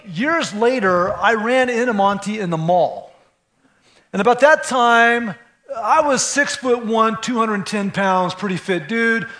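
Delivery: usual speaking voice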